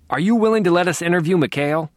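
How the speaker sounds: demanding. A narrator's reading. Reporter to detective, loud and demanding. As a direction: press the detective hard for an answer. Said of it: "Sangre demanded".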